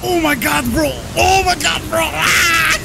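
high shouting with a laugh; excited shout. Real, not acted. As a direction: yell in shocked delight. Shocked by a game moment.

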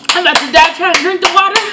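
frantic. Real, not acted. Gasping after hot food.

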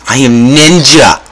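slow playful ninja brag; mock ninja. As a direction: play a proud cartoon ninja.

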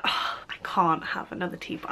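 soft unvoiced aside of mock guilt; whispered guilt. Telling viewers her teapot excuse, softly whispered.

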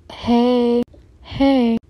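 ordinary talk, nothing marked

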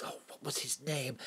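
a soft unvoiced whisper to himself; whispered aside. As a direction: murmur it as if thinking aloud. Muttering to himself, recalling names.

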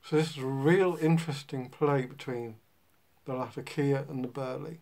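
talking around clenched pipe stem; words slightly muffled by pipe; speaking with pipe in mouth. Real, not acted. Seen 1: Pipe stem clenched in teeth throughout the speech; smoke rising.

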